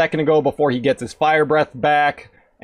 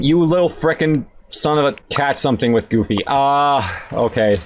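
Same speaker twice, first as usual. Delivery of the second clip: usual speaking voice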